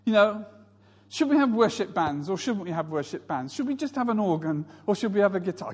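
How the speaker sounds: ordinary talk, nothing marked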